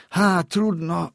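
slow, high sigh of resignation; worried resignation. A narrator's reading. Boy thinking over his first school day, resigned. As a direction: draw it out, resigned to trouble. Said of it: "zamyślił się frasobliwie".

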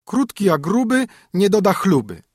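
high, teasing sing-song rhyme; a haughty princess mocking a fat knight in verse; princess mocking a suitor. A narrator's reading. Princess sneering at a suitor, teasing and high.

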